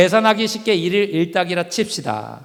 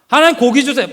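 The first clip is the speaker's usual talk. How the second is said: higher, louder whiny plea; begging again; 5 semitones higher, 5 dB louder than usual. Acted. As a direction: nag harder than the first. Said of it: "계속 졸르기 시작했어요".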